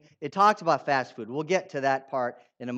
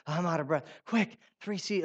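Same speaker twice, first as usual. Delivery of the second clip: hurried, breathless. Retold or imitated. Abraham rushing Sarah to bake, mock breathless hurry. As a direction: blurt it like a man rushing guests' food. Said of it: "he's almost talking so fast".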